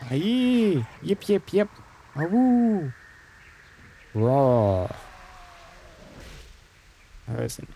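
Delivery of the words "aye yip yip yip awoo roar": wolf voice. Retold or imitated. Imitating gnoll yips and howls.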